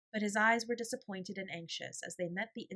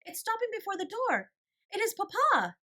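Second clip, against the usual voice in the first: much higher, louder excited cry; excited exclaim; 8 dB louder than usual. A narrator's reading. Janet spotting her papa's cab, much higher and louder. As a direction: burst out seeing the cab. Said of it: "exclaimed Janet".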